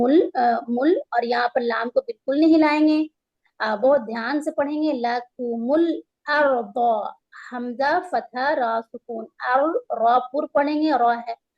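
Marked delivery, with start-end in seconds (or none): 4.9-6.8 الارض with a full, thick ر; slow, rounded heavy ra; موٹا را. Shown as a demonstration. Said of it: "را موٹے پڑے جائیں".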